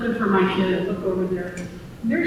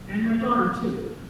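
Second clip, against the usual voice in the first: usual speaking voice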